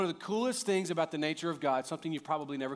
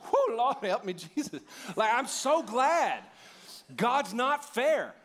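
fervent preaching; 8 semitones higher, 3 dB louder, slower than usual. Acted. Preaching emphasis.

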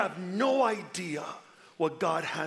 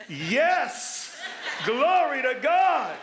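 a big, slow, much louder, higher cry of praise; joyful shout; 7 semitones higher, 5 dB louder, much slower than usual. Real, not acted. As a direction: shout it out in real delight. Celebrating becoming empty nester.

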